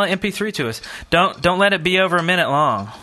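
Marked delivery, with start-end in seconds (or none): none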